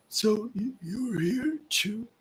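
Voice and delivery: put-on hopeful old man. Old man hopes for help.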